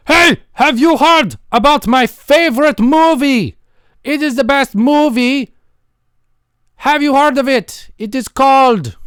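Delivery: high, loud, slow gushing; hammed-up loud movie fan; overloud fan. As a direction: shout about your favourite movie. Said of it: "Why are you talking so loud?".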